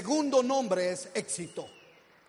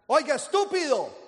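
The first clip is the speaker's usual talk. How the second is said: higher and louder yell; shouting; 6 semitones higher, 8 dB louder than usual. Shown as a demonstration. Yelling at strangers on the street, loud shout. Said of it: "y grita".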